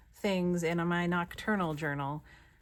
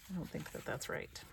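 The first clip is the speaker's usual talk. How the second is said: whispered aside; 10 dB quieter than usual. Real, not acted. Aside about the video.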